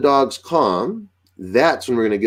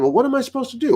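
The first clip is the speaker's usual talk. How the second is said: higher-pitched questioning dog voice; puzzled dog; much faster than usual. Acted. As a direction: lightly voice a dog asking for guidance. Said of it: "'cause the dog's saying".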